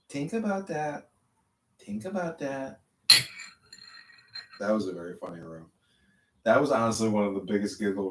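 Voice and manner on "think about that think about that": higher slow mock hypnotist. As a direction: parody the guru lightly. Mimicking the mantra.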